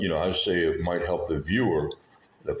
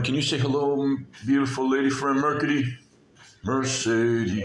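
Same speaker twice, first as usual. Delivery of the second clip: singsong puppet show host; 6 semitones higher than usual. Acted. Coaxing a toy to greet.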